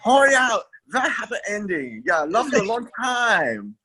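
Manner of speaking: hammy fake Chinese accent; bad Chinese accent. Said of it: "worst Chinese accent ever".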